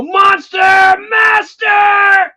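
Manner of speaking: very high, very loud and drawn out; mock frustrated shout. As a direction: scream it slowly like a possessed villain stuck in traffic. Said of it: "I'll go".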